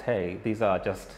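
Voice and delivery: ordinary delivery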